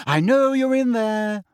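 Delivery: put-on high, slow girl's voice through a door; Hermione's voice. A narrator's reading. Hermione calling through the door, high and slow. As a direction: call through the door as a young girl. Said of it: "said Hermione's voice".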